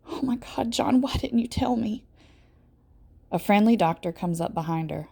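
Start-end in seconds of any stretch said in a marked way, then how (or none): 0.1-2.0 breathy, high anguished whisper; a mother's stricken whisper. A narrator's reading.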